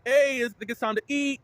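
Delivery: imitated calling out. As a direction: call the guests over to the food. Host calling guests to eat.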